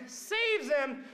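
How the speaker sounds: usual speaking voice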